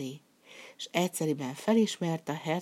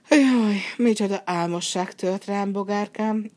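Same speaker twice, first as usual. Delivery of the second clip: loud, higher yawning complaint; 4 semitones higher, 7 dB louder than usual. Acted. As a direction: stretch the words through a big yawn. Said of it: "ásítozott".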